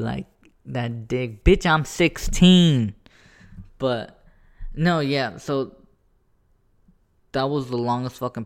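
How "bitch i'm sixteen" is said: slow, louder, higher mock outrage; mock indignant retort. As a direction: drag it out, offended. Retort to imagined old lady.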